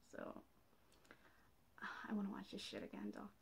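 fast, soft whisper after a sigh; hushed whisper to herself. Real, not acted. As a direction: breathe it out under your breath. Muttering after the video ends.

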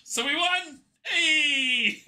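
high laughing shout of joy; shrieking jackpot winner. Real, not acted. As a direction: squeal and laugh with delight. Celebrating the win.

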